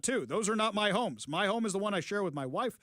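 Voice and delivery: ordinary talk, nothing marked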